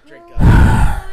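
shouted drink up, pitched high; loud call. Real, not acted. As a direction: bellow it to the whole room. Host calls everyone to drink.

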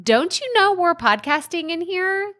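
high, exasperated call at the neighbour; a playful telling-off, pitched high; mock scolding. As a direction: pretend to yell through the window. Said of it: "bang on my window and say".

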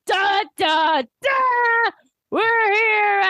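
high, triumphant entrance fanfare; Trunks. Retold or imitated. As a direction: sing out a grand mock ta-da.

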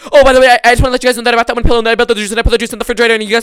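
rattled off fast and loud, high and breathless. Retold or imitated. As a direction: rush it out loud to beat the interruptions. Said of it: "I had to talk so fast and loud".

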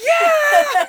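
very high, very loud whoop; jubilant yell. Retold or imitated. Randy hears his student loans are gone, jubilant yell. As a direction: scream it in imitated joy.

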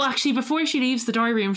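ordinary talk, nothing marked

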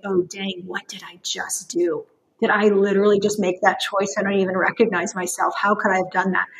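low, hushed voice of mild dismay; soft, low muttering to yourself in regret; regretful self-talk. Acted. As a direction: play someone quietly questioning their own choice. Acted regretful self-talk after mistake.

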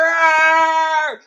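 high, very loud roar; long playful roar; bear roar. Pretend. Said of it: "let's make noises like a bear".

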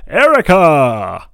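loud, high roll call of names; announcer read. Acted. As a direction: announce each name like a star. Reads patron names grandly.

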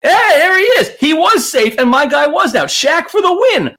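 loud, high celebration; cheering. Retold or imitated. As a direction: whoop for the winner. Cheering a teammate's win.

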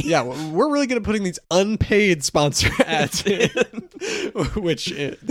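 laughing through the line; giggling while joking about unpaid ads; real laughter. Real, not acted. Jokes about unpaid sponsor ads.

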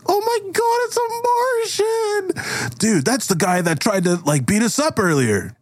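high-pitched alarmed squeal; shrieky high girl voice; panicked party girl. Acted. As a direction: squeal it in horror, then gossip urgently. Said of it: "one of the girls says".

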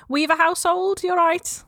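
put-on Nana answering the phone in a light Scouse accent. Nana answers her daughter's call, lightly Scouse. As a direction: pick up cheerily as a Liverpool nan. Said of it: "This is not a good accent, nor is mine either".

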